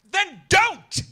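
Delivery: high, loud retort; snapping back. Retold or imitated. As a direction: bite back hard and loud. Snapping back at the young man.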